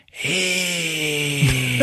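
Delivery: soft, high drawn-out creature cry; long, thin creature screech; imitated monster screech. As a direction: hold a quiet monster shriek. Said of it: "it's going like".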